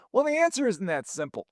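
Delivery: ordinary delivery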